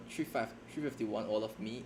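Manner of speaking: ordinary talk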